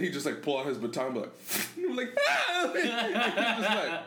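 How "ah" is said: very high, short yelp; sudden high comic scream; mock scared shriek. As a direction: imitate a startled scream. Acts out reaction to baton.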